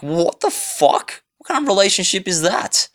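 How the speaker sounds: you can't believe what you're hearing; incredulous outburst. Retold or imitated. Hearing the friend's "own terms" excuse, incredulous and pitched high. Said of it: "I'm like".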